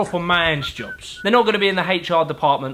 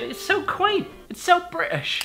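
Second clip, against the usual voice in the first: high, breathy mock American gushing; gushing American tourist; 12 semitones higher, 3 dB quieter than usual. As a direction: gush like a starry-eyed tourist, pitched up high.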